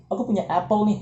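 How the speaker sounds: bragging in Indonesian; mock show-off. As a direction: flaunt the new phone.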